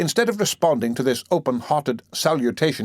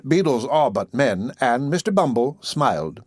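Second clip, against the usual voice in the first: usual speaking voice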